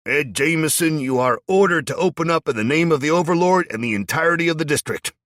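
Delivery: loud, high official order; commanding shout. A narrator's reading. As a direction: command them to open up. Raider ordering from the door.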